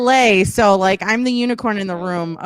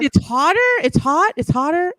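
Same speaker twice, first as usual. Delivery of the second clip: puzzled listeners; 6 semitones higher than usual. Retold or imitated. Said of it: "And they're like".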